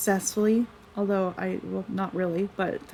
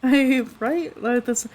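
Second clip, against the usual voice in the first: ordinary talk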